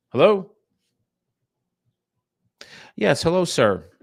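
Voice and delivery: high, bright phone greeting; friendly salesman on the phone; sales call voice. Real, not acted.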